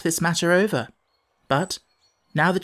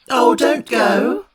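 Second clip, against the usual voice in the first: pleading cry; 6 semitones higher, 6 dB louder, much slower than usual. Acted. Children pleading with the fairy.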